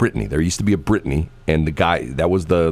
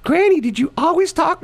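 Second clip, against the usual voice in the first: grandkid voice. Retold or imitated. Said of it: "Like, like".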